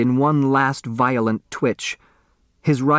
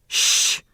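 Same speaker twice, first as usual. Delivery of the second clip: sharp shush. Acted. Hushing the others.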